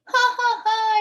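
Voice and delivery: loud, high mocking laugh; gloating yell. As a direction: crow it out in triumph. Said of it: "I yelled".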